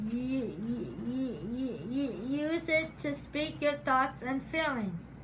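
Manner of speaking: repeating the first sound; real stutter on use. Real, not acted. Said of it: "as a stutterer".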